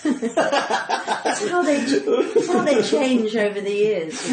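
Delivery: slow words bubbling with real laughter; giggling over her own cheeky book chapter; laughing through it. Real, not acted. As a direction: let the laugh carry the repeat.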